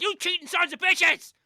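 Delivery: high, loud mock-enraged yell; imitating an outraged father's shout; furious dad. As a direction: holler it like a dad giving chase. Retold dad chasing cheating sons.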